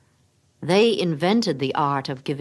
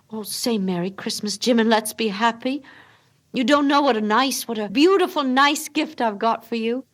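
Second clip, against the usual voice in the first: put-on higher, louder pleading young wife; Della's voice; 7 semitones higher, 4 dB louder than usual. Young wife begging husband not to mind, higher and urgent. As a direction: rush to win him over, anxious and hopeful.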